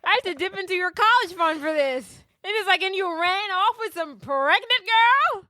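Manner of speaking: hammed-up dad scolding his daughter; mock angry dad. Podcaster imitating Lucy's father scolding her, a mock rant. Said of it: "he's yelling at her".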